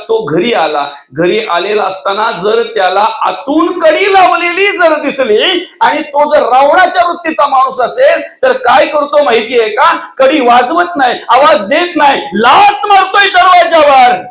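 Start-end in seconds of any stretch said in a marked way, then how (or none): 3.2-14.2 climbing from raised to a full shout at the kick; rising preaching fervour. Acted. Preacher building to a shout as Ravana kicks the door.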